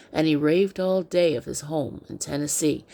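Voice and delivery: ordinary delivery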